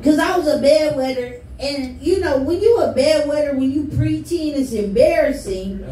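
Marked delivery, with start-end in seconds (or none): none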